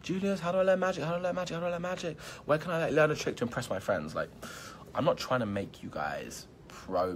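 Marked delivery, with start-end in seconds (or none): 0.0-4.1 high-pitched mock pestering fan voice; imitating eager fans. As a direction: mimic fans begging over and over, pitched way up. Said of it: "people who were like".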